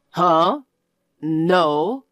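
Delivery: ordinary delivery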